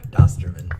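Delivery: mock German.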